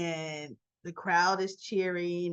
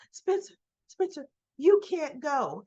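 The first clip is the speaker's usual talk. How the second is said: higher-pitched imitation of calling after someone; mock urgent call to stop him; retold calling out; 5 semitones higher, 3 dB louder than usual. Esme stops Spencer from leaving, imitated calling out.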